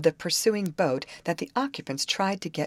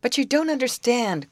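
raised, louder plea; growing desperate; 9 semitones higher, 5 dB louder than usual. A narrator's reading. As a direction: cry out with a touch of desperation. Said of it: "cried the man, seemingly growing desperate".

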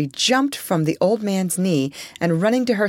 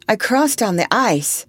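higher, earnest statement; earnest answer; 6 semitones higher, 4 dB louder than usual. A narrator's reading. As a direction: state it earnestly, falling at the end. Eliza answers how she came.

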